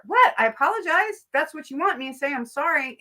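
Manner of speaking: high, indignant "What? I apologize?"; mock-outraged sorry-not-sorry; defensive fake apology. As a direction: scoff as if the demand is absurd.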